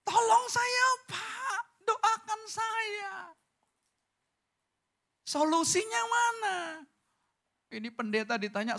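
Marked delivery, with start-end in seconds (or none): 0.0-3.1 high, loud, tearful begging for help; crying plea. Retold or imitated. Acts a crying congregant. 5.3-6.6 high, loud demand for answers. Retold or imitated. Acts congregant demanding.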